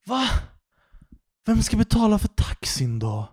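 high falsetto bewildered whine; puzzled drunk woman asking who pays, in a squeaky high voice; confused drunk woman. Retold or imitated. Mimicking the confused drunk passenger, high falsetto. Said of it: "Hon bara".

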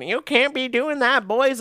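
high, loud mock scold of a hockey mom; hockey mom chirp. Hockey mom scolding the boys, high and loud. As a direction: squawk it like a nagging hockey mom. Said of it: "and she's going".

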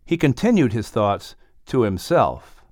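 ordinary delivery

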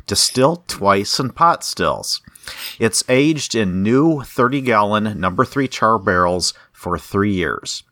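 ordinary talk

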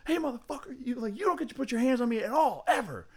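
very high-pitched mock confrontation; put-on high voice, squaring up for a fight; imitated angry outburst. Fighter reacting to a hand on his shoulder, mock angry outburst.

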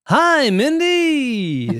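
very slow, high announcer voice; radio voice. Shown as a demonstration. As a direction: stretch the greeting like a cheesy radio host. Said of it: "What if I talk like this".

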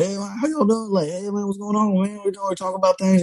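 high-pitched Cajun greeting patter; thick Cajun accent. Acted. Back from Louisiana greeting people, high-pitched Cajun accent. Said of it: "I start to pick up my Cajun accent again".

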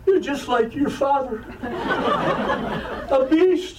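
high-pitched mock tearful accusation; mother still crying. Mother accusing her son through tears, mock crying. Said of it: "still crying".

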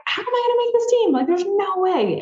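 high, squeaky panic; panicky inner voice. Retold or imitated. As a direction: replay the panic in your head. Retold panicked self-doubt.

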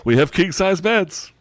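eager bartender voice. Acted. Bartender upsells bed.

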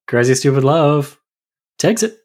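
usual speaking voice

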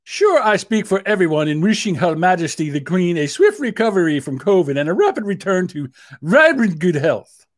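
mock British statesman; horrible British accent. Prime Minister wishing the Queen well, in a horrible British accent. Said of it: "I love doing my horrible British accent".

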